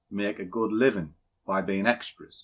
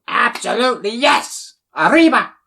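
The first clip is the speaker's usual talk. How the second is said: loud, high hammed-up Mexican-Argentinian-Italian accent; mock Mexican-Italian accent; 13 semitones higher, 9 dB louder than usual. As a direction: belt it out in a jumbled Mexican-Italian accent, mocking a trader. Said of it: "no idea what his accent is".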